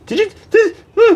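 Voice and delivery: very high, incredulous half question; squeaky disbelief from [name]; mock coworker. Coworker voiced in retold story.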